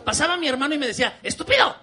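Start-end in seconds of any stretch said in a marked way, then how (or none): 1.2-1.8 high mock yell of a sibling insult; kid insult. Brother yelling stupid at his little brother, high mock yell. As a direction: snap it like a bratty brother.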